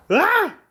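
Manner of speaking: sudden high-pitched shriek; startled high yelp. Real, not acted. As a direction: yelp in fright. Bird flies at him.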